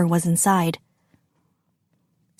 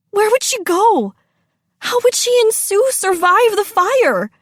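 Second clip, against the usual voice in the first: high, anxious narration; panicked questioning; 10 semitones higher than usual. A narrator's reading. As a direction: voice the girl's rising panic. Narrator voices girl's panicked thoughts.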